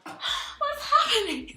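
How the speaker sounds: high, confused yelps, slowing down; baffled and looking around; startled. Retold or imitated. Startled by the catcalls.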